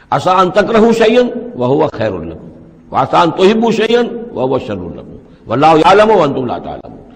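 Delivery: Quranic recitation. Acted. Teacher reciting the verse to his students, chanted. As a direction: recite the verse in tajweed style.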